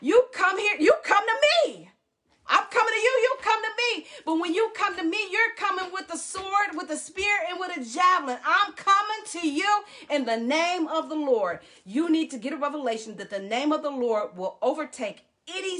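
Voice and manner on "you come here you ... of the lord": bold declaration. Retold or imitated. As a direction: proclaim it boldly back at the giant. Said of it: "David said to the Philistine".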